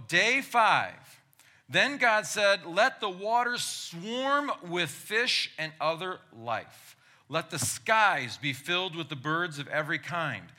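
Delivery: loud, high preacher reading scripture; booming biblical narration. Acted. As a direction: proclaim it like from the pulpit. Reads Genesis aloud.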